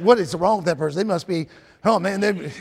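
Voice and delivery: low, hushed aside; imitating someone quietly taken aback; puzzled onlooker. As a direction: mutter it like a baffled bystander. Voicing puzzled onlookers' reaction.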